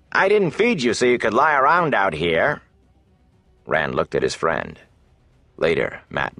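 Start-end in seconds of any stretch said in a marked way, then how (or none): 0.1-2.6 gruff scolding innkeeper. Acted. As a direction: scold them like a stingy boss. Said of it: "Hake barked".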